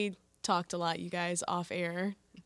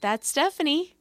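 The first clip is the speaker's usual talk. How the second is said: excited; 8 semitones higher, 11 dB louder than usual. Real, not acted. Excited correction, loud.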